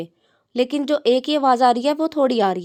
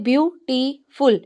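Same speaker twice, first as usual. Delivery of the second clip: Beautiful in three separate beats; broken into syllables; much slower than usual. Shown as a demonstration. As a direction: pull beautiful apart, a short stop after each syllable. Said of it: "अलहदा-अलहदा करके भी".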